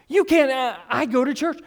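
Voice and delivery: high-pitched, flustered mock protest; put-on indignant objection, pitched well up; mock affront. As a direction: sputter like an offended churchgoer. Mimics an affronted churchgoer.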